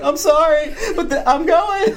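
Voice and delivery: high, giddy, laughing excuse; mock apology. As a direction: apologize while too thrilled to mean it. Retold giddy apology to his wife.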